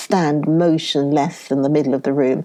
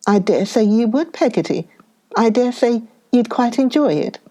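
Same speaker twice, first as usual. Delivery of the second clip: higher, cutting sarcastic jab; bitter sarcasm; 6 semitones higher than usual. A narrator's reading. Mother taunting Peggotty sarcastically, raised pitch. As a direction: sneer it at her.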